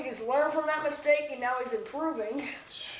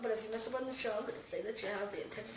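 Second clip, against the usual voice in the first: dropped, much softer voice; hushed warning; 3 semitones lower, 10 dB quieter, much faster than usual. Real, not acted. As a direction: lower the volume for a teasing warning. Restarts teasing warning, now hushed.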